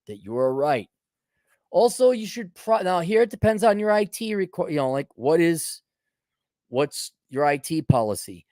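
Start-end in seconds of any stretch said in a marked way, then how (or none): none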